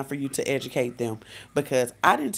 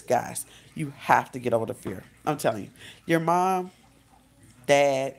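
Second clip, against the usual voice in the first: normal talking voice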